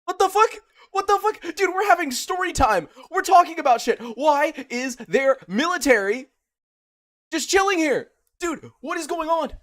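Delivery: very high, loud outraged screaming. Real, not acted. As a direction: scream it in real disbelief at the game. Outraged at game military kills.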